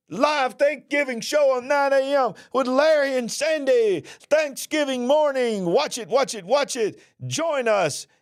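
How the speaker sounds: excited promo. Acted. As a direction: sell the show like a barker. Hyped show promo.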